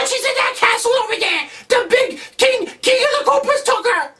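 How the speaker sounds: put-on very high, shouting little kid; panicked kid voice. Mushroom kid cries to Mario, high panicked squeal. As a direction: squeal it like a frantic tiny child. Kids answer where princess is.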